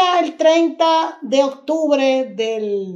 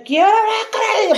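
pitched high and raised so the boss next door hears; calling out to be overheard; 10 semitones higher than usual. Retold or imitated. Fired employee calling so the hidden director overhears, raised voice. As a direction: aim the line through the wall at the director. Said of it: "para que oyera".

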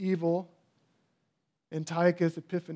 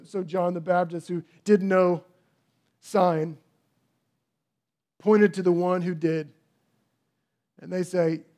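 normal talking voice

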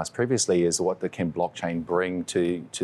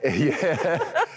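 laughing; 9 semitones higher than usual. Real, not acted. Laughs at accent joke.

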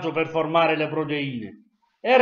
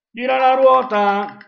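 game show host; 7 semitones higher, 6 dB louder, slower than usual. Pretend. Spinning the quiz wheel.

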